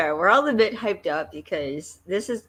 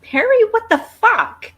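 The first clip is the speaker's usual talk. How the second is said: loud, slow, high outraged yell; shocked shout; 12 dB louder, slower than usual. Real, not acted. Hosts react to a shock in the episode, shouting. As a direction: scream his name in disbelief.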